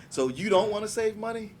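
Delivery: pushy salesman. Retold or imitated. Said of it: "I get the ones like".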